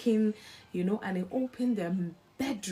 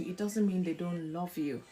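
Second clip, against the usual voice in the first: usual speaking voice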